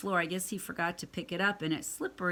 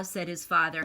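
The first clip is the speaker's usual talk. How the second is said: ordinary delivery, nothing marked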